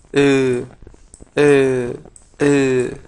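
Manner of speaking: "eh" as a held, loud eu; firm demonstration "eh" sounding like eu; closed "eu" vowel. Shown as a demonstration. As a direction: say each "eh" as eu, teeth together. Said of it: "se pronuncia como si fuera eu".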